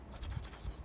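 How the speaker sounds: soft whispered drip-drop noises; imitated rain patter. Showing viewers how hard it rains, whispered rain patter. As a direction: patter like raindrops, all breath. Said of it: "どのぐらいの雨の音やってみ".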